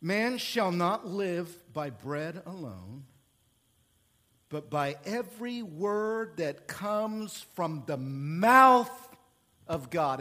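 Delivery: slow, weighty recitation; preacher reading Jesus' reply, slow; solemn scripture reading. Real, not acted. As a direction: let every word land slowly. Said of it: "It is written".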